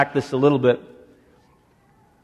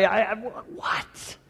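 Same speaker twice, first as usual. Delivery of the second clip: stuttering Moses; 5 semitones higher, 10 dB quieter, much slower than usual. Acted. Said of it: "I stutter".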